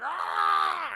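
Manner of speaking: high, loud attack yell; battle cry. Acted. Hero leaps down on Kingpin, loud battle cry. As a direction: scream as you strike down.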